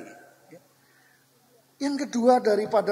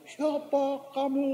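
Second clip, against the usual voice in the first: high, slow, softer echo of a call; distant echo with stretched vowels; echo answering back; 7 semitones higher, 3 dB quieter, slower than usual. Retold or imitated. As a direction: imitate a ravine echoing back, drawn out. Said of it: "jawaban dari balik jurang".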